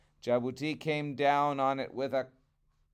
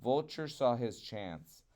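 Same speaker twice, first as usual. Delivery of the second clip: ordinary delivery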